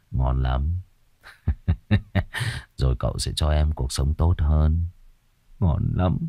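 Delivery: low, soft gloating; smug satisfied leer. A narrator's reading. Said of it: "mãn nguyện".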